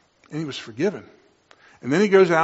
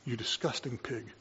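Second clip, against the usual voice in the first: quiet, bitter self-contempt; self-loathing mutter; 13 dB quieter than usual. Retold or imitated. Believer to own reflection, quiet contempt. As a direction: sneer it softly at the mirror. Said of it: "and we say".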